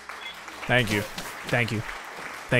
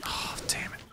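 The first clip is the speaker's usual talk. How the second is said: whispered curse; 17 dB quieter than usual. Real, not acted. Hiding in grass, caught.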